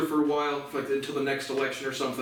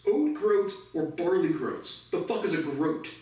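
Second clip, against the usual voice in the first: normal talking voice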